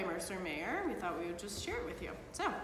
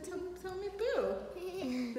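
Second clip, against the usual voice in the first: imitating child; 9 semitones higher, much slower than usual. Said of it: "You just said".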